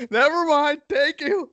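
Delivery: squeaky high "never mind, thank you"; playing someone sheepishly backing off at the door; high silly voice. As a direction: pitch it way up, mock polite. Acting skit, high voice.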